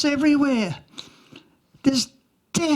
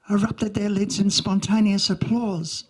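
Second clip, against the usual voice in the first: ordinary talk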